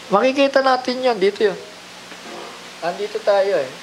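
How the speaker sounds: usual speaking voice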